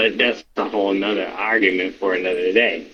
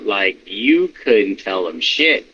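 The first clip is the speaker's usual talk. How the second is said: ordinary talk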